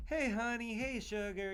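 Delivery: put-on a touch of Southern accent, voice lifted; Southern greeting. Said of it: "everybody down in the South is like".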